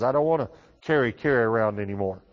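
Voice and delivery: usual speaking voice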